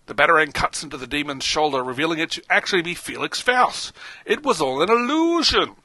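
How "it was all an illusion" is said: dramatic reveal. Acted.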